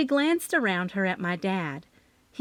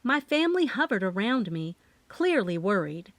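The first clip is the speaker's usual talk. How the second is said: ordinary delivery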